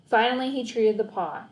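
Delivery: plain reading voice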